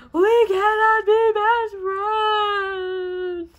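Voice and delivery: slow, loud, wailing cry. Acted. Hog wailing to Harold that they can't be friends. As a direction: let the voice break into a wail. Sad realization, voice breaks.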